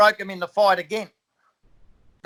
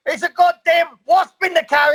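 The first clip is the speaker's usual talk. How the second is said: high, loud frantic excuse; retold frantic yell about a wasp; frantic shout; 9 semitones higher, 4 dB louder than usual. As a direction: yell the excuse like it's obvious.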